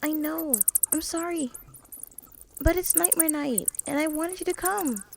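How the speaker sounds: high, hopeful calling voice. Acted. Twilight calling for Ruby in the dark castle, high and hopeful. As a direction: call into the empty halls, bright and high.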